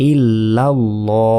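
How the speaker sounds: "ইল্লাল্লাহু" very slow, with a long rounded "ল"; Arabic Lam as LO. Shown as a demonstration. Qur'an teacher joining the word, long rounded "ল". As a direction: stretch the second Lam to LLO, then short "হু". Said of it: "এখানে ল কেন বললাম?".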